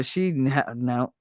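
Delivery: normal talking voice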